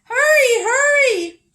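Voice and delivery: very high loud urgent cry; urgent shout. Acted. Grandmother at the door to fleeing Squirrel, urgent high shout.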